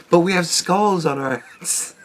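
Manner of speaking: soft, giggling line; laughing through words. Real, not acted. Repeating his skull hats joke, giggling. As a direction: talk while still laughing.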